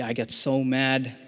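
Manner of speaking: ordinary delivery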